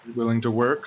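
ordinary talk, nothing marked

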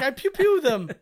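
put-on high, loud kid voice with pew sounds; dad doing his son's line; little son voice. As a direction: play the boy proudly pewing. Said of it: "He's like".

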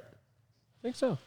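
high, soft, slow hedging; not confident. Real, not acted. Host doubting his own deal read, unsure. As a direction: trail it out in a small, unsure high voice. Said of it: "Sometimes I'm not confident".

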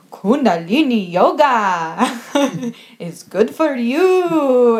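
imitating a friend's loud strange accent, slowly. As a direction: drag it out like a tripping guru. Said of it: "in this really strange accent".

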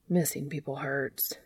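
soft, low murmur. A narrator's reading. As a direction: let it slip out quietly. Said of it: "the dandelion murmured".

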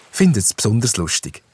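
plain reading voice

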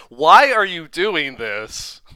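loud, drawn-out exasperated plea; mock exasperation. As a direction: stretch it out, loud and baffled. Mock exasperated question to the actress.

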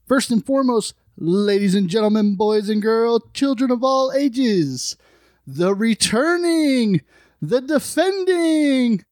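slow, high ringmaster announcement. Acted. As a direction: announce him like a circus ringmaster. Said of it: "I love your attempt".

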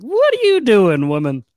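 high, loud, hammed-up disbelief; indignant Reverend Mother. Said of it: "you can almost hear the Reverend Mother".

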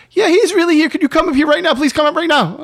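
very high, loud panicked caller; frantic pleading. Retold or imitated. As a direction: imitate a flustered staffer begging for help.

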